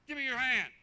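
loud, commanding teacher; barked classroom command; stern teacher order. Retold or imitated. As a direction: order the boy to hold out his hand.

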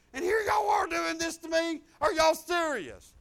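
high, loud protest building to a shout; indignant outburst. Retold or imitated. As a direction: confront them in rising disbelief. Retold outburst at church coworkers.

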